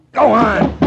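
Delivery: loud, high command; sharp order. Acted. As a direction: bark it to force him in. Detective orders Douglas inside.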